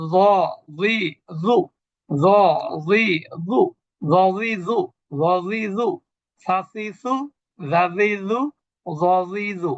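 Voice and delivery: "জ, জি, জু" one syllable at a time, tongue tip at teeth edge; chant-like drill of "জ, জি, জু" and "সা, সি, সু"; tongue-tip letter drill. Shown as a demonstration. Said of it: "এই তিনটা হলো জিহ্বার আগা". ("জ, জি, জু" জিহ্বার আগা উপরের দাঁতের আগায় রেখে উচ্চারণ)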